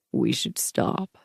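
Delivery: a very low, breathy rumble of a lover; slow, low rumble with no voice behind it. A narrator's reading. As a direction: rumble it slowly, dropped very low. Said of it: "he rumbles".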